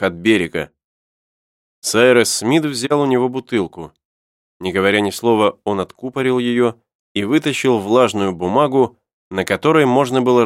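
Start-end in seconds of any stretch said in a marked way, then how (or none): none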